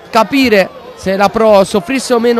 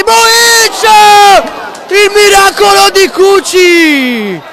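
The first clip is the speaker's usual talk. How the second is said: shouted, high save call; excited save call; 12 semitones higher, 8 dB louder, much slower than usual. Acted. Save commentary.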